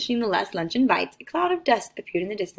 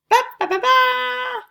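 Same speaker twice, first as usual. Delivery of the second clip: trumpet fanfare; 5 dB louder, slower than usual. A narrator's reading.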